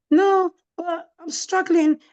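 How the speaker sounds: mock whimper to her daughter, drawn out; whiny struggling. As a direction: plead weakly for sympathy. Mum answers daughter's challenge.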